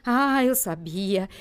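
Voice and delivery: usual speaking voice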